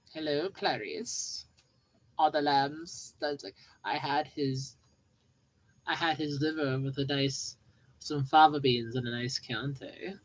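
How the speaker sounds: a light menacing murmur of the cannibal; Hannibal Lecter impression.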